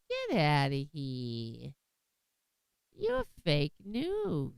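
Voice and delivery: slow, mocking brush-off; dismissive woman. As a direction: drag it out like a stubborn denier. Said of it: "And she's like".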